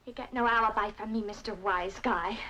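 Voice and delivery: low, scornful dismissal; cold refusal. Acted. Woman refusing her lover an alibi, cold and low. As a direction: drop low and shut him out.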